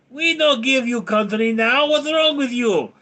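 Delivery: put-on high, loud comic Russian-accented protest; mock-Russian accent. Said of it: "And Ukraine say".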